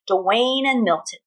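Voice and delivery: ordinary delivery, nothing marked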